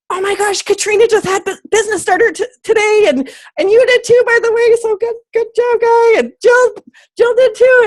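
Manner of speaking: very high, gushing squeal; squealing excitement. Retold or imitated. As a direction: squeal like a giddy third grade girl. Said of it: "I'm like".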